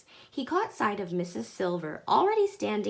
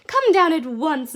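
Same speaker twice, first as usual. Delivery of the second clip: excited call; 8 semitones higher, 10 dB louder than usual. A narrator's reading. Excited reply from below.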